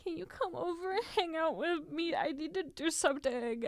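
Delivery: whiny pleading voice; begging in a needy whine. Retold or imitated. Begging friends after breakup.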